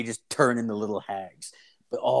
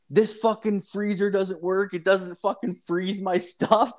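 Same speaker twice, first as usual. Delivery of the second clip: retold angry complaint; 8 semitones higher than usual. Customer complains about the beer fridge, high mock-angry voice. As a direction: imitate a worked-up man griping in a much higher voice. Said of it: "there's this dude yelling".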